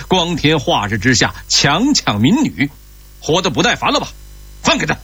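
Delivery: high-pitched, indignant official's scolding; angry rebuke. A narrator's reading. Official confronting three thugs, angry rebuke. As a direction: rebuke them, then order the release. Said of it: "他怒视着三个歹徒".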